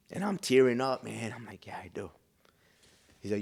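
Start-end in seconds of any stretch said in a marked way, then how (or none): 1.7-2.1 low, very soft near-whisper; hushed admission. Retold or imitated. Tearing up before the preacher, hushed admission. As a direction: admit it quietly, barely voiced.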